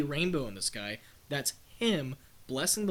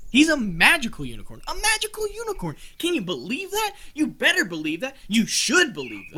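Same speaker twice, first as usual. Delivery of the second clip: loud, high preaching build; zealous proclamation; 9 semitones higher, 7 dB louder than usual. Acted. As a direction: hammer the faith home.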